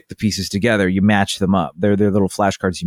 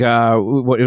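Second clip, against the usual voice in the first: ordinary talk, nothing marked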